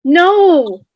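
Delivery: loud cry of refusal; anguished no. Acted. Max crying no at Billy, loud. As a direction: cry out against it.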